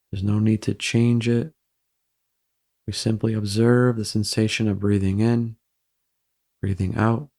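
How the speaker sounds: even, unhurried meditation voice with long pauses; guided meditation. Real, not acted. Guiding listeners through breathing to ease embarrassment, calm meditation voice. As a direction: guide them gently into stillness, evenly and calmly.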